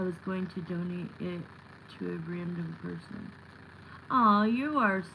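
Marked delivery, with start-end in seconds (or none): none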